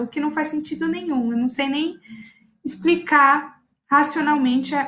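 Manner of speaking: normal talking voice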